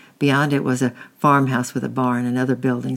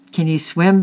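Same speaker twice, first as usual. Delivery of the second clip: loud, higher bellowed question; 5 semitones higher, 7 dB louder than usual. A narrator's reading. Angry man bellows at boys in boat. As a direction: bellow it across the water. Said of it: "bellowed the angry man".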